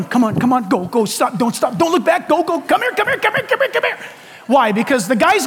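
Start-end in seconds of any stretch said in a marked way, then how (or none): 0.0-4.0 hammed-up movie extra waving someone to safety; frantic urging. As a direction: panicky urging climbing higher and higher with a laugh. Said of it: "there's always somebody going".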